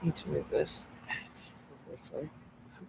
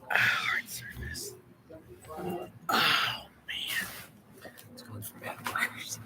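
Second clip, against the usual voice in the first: whispering; 7 dB quieter, much slower than usual. Real, not acted. Whispered complaint.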